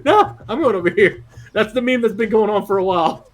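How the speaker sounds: laughing. Real, not acted. Laughing after the calls.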